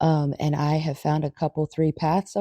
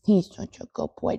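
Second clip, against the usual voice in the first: usual speaking voice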